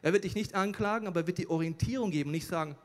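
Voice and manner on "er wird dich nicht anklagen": ordinary talk, nothing marked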